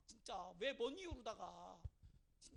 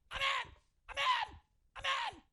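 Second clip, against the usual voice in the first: sudden high, loud amen bursts; shouted amen; 12 semitones higher, 10 dB louder, slower than usual. Retold or imitated. Seminary student suddenly bursting out with amen. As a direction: startle the room with amen. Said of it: "경기 나가지고".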